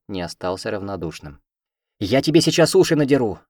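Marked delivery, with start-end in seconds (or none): 2.0-3.4 loud, higher yell while chasing; shouting threat. A narrator's reading. Heroine shouts at the fleeing cat. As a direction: yell it as you run. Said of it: "кричу, погнавшись за ним".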